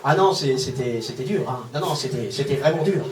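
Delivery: low, soft, halting confession; subdued, faltering mock sorrow; mock pained. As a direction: play wounded, dropping low and quiet. Mock-pained aside after hospital gag.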